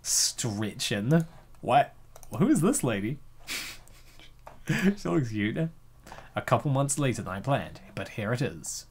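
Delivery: usual speaking voice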